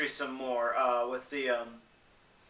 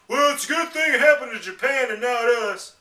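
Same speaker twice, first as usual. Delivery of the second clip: higher, louder smug tone; mock callous remark; 6 semitones higher, 6 dB louder than usual. Imitating people glad it hit Japan, in a higher, smug mock voice. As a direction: sneer it like an uncaring bystander. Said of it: "two people actually say this".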